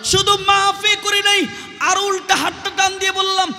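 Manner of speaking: high, loud sustained sermon cry; full-force preaching. Acted. Preacher retelling forgiveness to the crowd, high impassioned chant.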